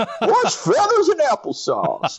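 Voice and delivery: high, drawn-out crusty prospector voice; old prospector. Shown as a demonstration. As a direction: holler it like a grizzled gold miner. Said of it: "I feel like it should be said like an old prospector".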